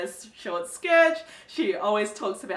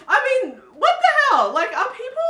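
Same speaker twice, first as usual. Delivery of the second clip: high, loud "what the hell"; a sudden loud cry of disbelief; disbelieving outcry; 12 semitones higher, 7 dB louder than usual. Real, not acted. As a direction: shout it in shocked disgust. Outburst at disgusting book.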